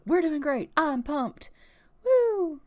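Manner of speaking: mock-energetic mom cheering, whoop at the end; pumped cheer. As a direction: sell it like a gym rah-rah. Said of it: "Esmeralda's like".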